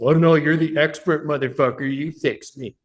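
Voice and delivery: lightly voiced snide client, mock politeness; a hint of passive-aggressive sarcasm; sarcastic prospect. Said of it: "the passive aggressive".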